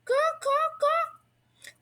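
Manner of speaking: high rising quacks like a rain bird; bird quacking. A narrator's reading. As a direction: squawk it out like the bird, high and sharp. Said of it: "ear-bursting rain cry".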